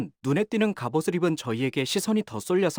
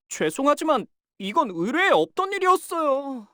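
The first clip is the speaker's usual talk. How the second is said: tense apology; 11 semitones higher, 3 dB louder than usual. Acted. Battle ult line.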